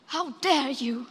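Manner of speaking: slow, indignant accusation; real outrage, each word weighted; angry rebuke. Real, not acted. Young activist rebuking leaders who look to youth for hope, angry. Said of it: "how sad and angry I am".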